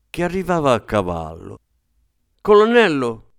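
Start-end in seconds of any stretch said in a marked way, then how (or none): 2.4-3.3 a high, piercing call, louder; shrill call. A narrator's reading. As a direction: shriek the title to get his attention. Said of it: "con voce stridula". (richiamo stridulo e acuto)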